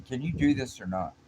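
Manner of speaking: high, loud retold challenge; acted impatience. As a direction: demand an answer like a fed-up coworker. Coworker loses patience, acted out.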